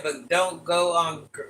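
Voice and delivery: ordinary delivery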